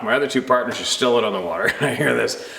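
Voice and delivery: ordinary talk, nothing marked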